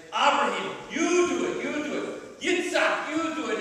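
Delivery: high, loud pushing it on others; urgent passing the buck. Retold or imitated. Passing the task along.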